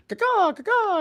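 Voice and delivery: high squawking caw; eagle caw imitation. Retelling a Trailer Park Boys scene with a high bird caw. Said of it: "and he's like".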